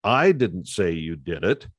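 "I" punched at the start, rest falls away; stress on I. Shown as a demonstration. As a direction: hit the word "I". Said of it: "put emphasis on a different word".

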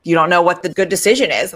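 ordinary talk, nothing marked

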